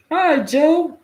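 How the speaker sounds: high, chirpy chorus reply; mock meeting crowd, high and cheery; group greeting. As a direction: greet him like the whole circle.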